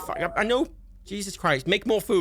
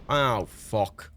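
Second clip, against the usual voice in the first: usual speaking voice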